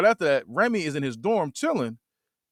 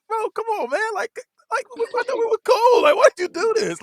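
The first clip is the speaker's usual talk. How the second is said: much higher whiny voice, pleading; hurt pleading roommate; 4 dB louder than usual. Retold or imitated. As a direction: plead like a betrayed friend. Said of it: "He said, he said".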